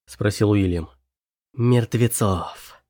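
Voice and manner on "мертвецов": ordinary delivery, nothing marked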